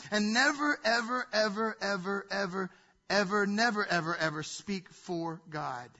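never ever ever speak for God; emphatic repetition. Real, not acted. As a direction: stress every never and ever, slowing at the end. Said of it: "repeated never ever for emphasis".

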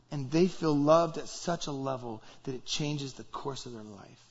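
ordinary talk, nothing marked

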